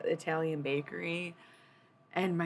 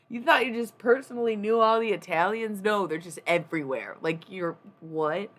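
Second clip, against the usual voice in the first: loud, higher teasing incredulity; imitating boss mocking; 5 semitones higher, 9 dB louder than usual. Boss teasing her naivety, loud mock disbelief. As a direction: rib her for thinking she knew every Italian. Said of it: "He's like".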